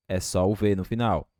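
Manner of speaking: ordinary delivery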